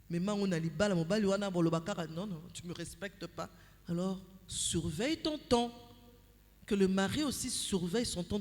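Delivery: normal talking voice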